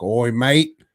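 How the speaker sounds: hammed-up "oi mate" accent with rising tags; mock German guy, Cockney-style accent. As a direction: pitch the deal in a broad comic Cockney-style accent. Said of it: "the German guy was like".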